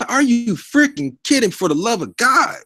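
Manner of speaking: incredulous outburst, slowed; exasperated. Acted. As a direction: throw your hands up at the critics. Exasperated at critics.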